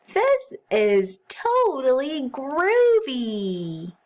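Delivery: high, loud and slow, savouring groovy; excited cry. Acted. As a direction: stretch it out, thrilled and cool. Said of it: "Pete the Cat cried".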